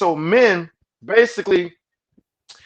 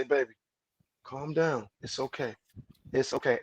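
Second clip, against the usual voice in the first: soft, low, coaxing; soothing calm-down; 4 semitones lower, 9 dB quieter than usual. Retold or imitated. Man calming his upset woman, soft and coaxing. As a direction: talk her down tenderly. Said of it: "you can set the tone and say".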